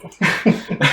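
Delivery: a brief emotional laugh; teary laugh. Real, not acted. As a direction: laugh through tears. Laughs while choking up.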